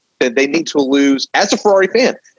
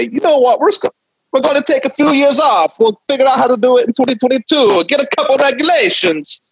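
high, hammed-up Italian accent, much higher; a bad Italian accent, pitched way up; 13 semitones higher than usual. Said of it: "poor attempt at an Italian accent".

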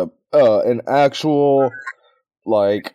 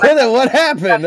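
high laughing "what happened"; laughing confused cry; 12 semitones higher, 5 dB louder than usual. Pretend. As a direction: panic through laughter. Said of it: "we're like".

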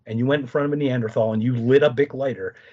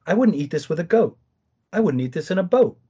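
raised, singsong nursery-rhyme recital; Doctor Seuss rhyme; 6 semitones higher, 4 dB louder than usual. Retold or imitated. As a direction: recite it like a kids' rhyme book, pitched higher. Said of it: "I start quoting Doctor Seuss".

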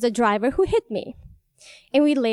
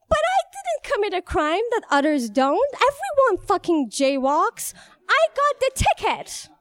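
high, aggrieved voice close to crying; tearful protest; 9 semitones higher than usual. Real, not acted. Jaywalker protesting she alone was ticketed, tearful protest. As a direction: protest the unfairness, voice climbing high.

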